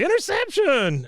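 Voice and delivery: high, drawn-out stadium call; stretched, high-pitched announcer shout; excited game announcer. Retold or imitated. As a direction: imitate an announcer crying out a big play. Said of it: "the announcer say".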